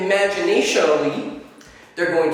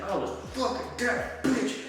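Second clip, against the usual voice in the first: crude boast; 6 semitones higher, 7 dB quieter, slower than usual. Shown as a demonstration. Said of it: "you can be like".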